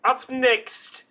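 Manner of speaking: high, loud announcement with the name stretched; announcer call. Pretend. Dean calls Raylu up next, loud announcer call. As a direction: call the next student up to the stage.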